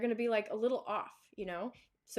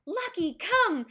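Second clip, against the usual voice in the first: high bright cheerful call, much louder; happy recall call; 9 semitones higher, 8 dB louder, slower than usual. Shown as a demonstration. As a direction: call the dog like you're thrilled. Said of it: "make it".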